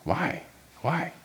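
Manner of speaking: soft, breathy, imitated lament; frustrated why. Pastor retelling her own frustrated question to God, hushed. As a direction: mouth a quiet frustrated why. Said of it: "frustrated at many points".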